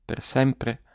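a hushed, halting "for ever?"; stammering. A narrator's reading. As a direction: barely get the words out, stunned by grief. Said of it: "balbettò".

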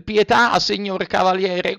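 high, begging cry for mercy; pleading. A narrator's reading. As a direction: beg at his feet. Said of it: "con voce supplichevole".

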